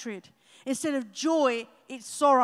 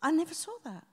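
higher, sudden "What?" of discovery; like spotting something new in a page you know well; surprised; 3 semitones higher, 3 dB quieter than usual. Retold or imitated. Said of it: "you're like".

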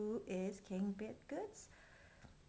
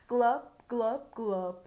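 glub drain sound; 10 dB louder, slower than usual. Acted. Drain noise.

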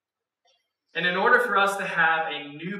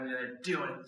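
hushed whisper; 6 semitones lower, 11 dB quieter than usual. Acted. Hushed moment of Peter walking.

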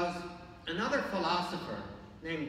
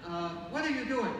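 raised, lightly put-on Irish lilt; Irish accent; 5 semitones higher, 3 dB louder than usual. Saint Augustine questioning the boy at the seashore, light Irish accent. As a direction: call out with a hint of an Irish accent. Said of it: "Sounds like an Irish accent".

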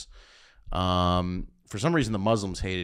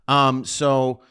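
normal talking voice

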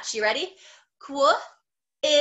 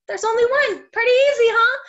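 excited; 9 semitones higher, 8 dB louder than usual. Real, not acted. Cheerful teacher praising the class.

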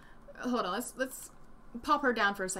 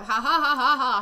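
loud mocking ha-ha laugh; exaggerated, forced cackling; mock laughter; 6 dB louder than usual. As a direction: fake a big sarcastic laugh. Mocks the prank as hilarious.

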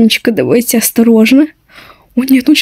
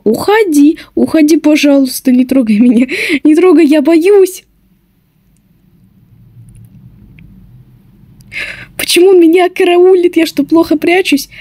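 being chased; 3 semitones higher than usual. Real, not acted. Seeker lingering near her.

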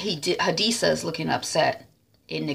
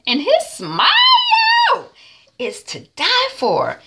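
high, loud, slowly stretched squeal; hammed-up swoon; 7 dB louder, slower than usual. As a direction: draw it out, swooning over him. Hadisa swoons over his smile.

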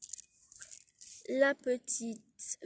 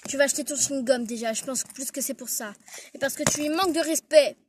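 fast, louder telling-off rising to a shout; angry scolding; 2 semitones higher, 8 dB louder than usual. Acted. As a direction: scold the rude pupil, louder and louder. Prof gronde l'élève insolente. (réprimande de plus en plus forte, rapide)

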